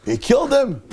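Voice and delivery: high-pitched dramatic announcement; storyteller's high, punched reveal; shocked exclamation. Retold or imitated. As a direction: burst out with the shocking news.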